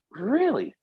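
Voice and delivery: high, surprised "really?"; shocked squeak of doubt; surprised disbelief. Retold or imitated. Player shocked at news.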